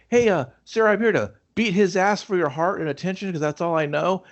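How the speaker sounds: high-pitched mock bravado; retold gassed-up Kip. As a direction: declare it like a pumped-up suitor, pitched high. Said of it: "I'd get Kip gassed up".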